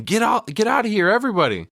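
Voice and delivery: hammed-up cry to clear the square; mock yell. Winnie warning the townsfolk to leave, mock yell. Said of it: "Winnie yells out again".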